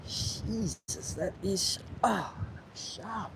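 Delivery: breathy pained strain, high; strained through pain. Real, not acted. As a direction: squeeze it out while hurting. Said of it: "Sorry for all the grunting".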